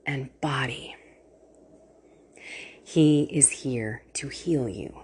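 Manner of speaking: soft trailing whisper. Real, not acted. Host closing her blessing in a whisper. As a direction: let the last word fade to a whisper.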